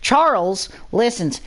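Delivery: plain reading voice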